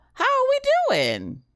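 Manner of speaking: high, sung-out how are we doing; sing-song greeting. Real, not acted. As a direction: lilt it up high to the chat. Streamer greets chat.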